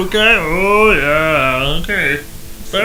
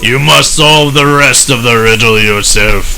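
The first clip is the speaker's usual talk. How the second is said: deep, loud, menacing boss; low villain voice; 12 semitones lower, 5 dB louder than usual. Acted. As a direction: speak as an ominous game villain. Voices a game character.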